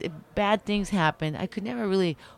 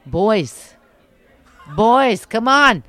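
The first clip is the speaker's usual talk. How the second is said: loud and higher, cheeky; obvious answer; 5 semitones higher, 11 dB louder, much slower than usual. Real, not acted. Obvious answer to how punk started.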